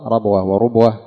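ربوة وربوة said "rabwa wa-rubwa"; first ربوة with a, second وربوة with u; fatha, then damma. Shown as a demonstration. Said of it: "فتح الراء وضم الراء". (ربوة بالفتح ثم وربوة بالضم)

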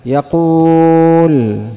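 يقول said slowly with a long ū; slow drawn-out yaqūl. Shown as a demonstration. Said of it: "خلاف ما تقدم في القاعدة". (يقول ببطء مع مد الواو)

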